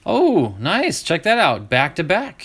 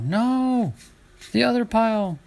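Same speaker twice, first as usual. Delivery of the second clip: drawn-out high cry, imitating exasperated viewers; mock yelling; 8 semitones higher than usual. Collector imitating viewers yelling at their monitors, mock high yell. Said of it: "you guys are yelling at the, your monitors".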